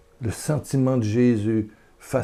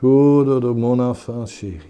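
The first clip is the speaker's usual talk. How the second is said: comforting divine voice; 4 dB louder than usual. Acted. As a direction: speak as a loving Father comforting his child. Voix de Dieu consolant. (voix du Père, lente et tendre)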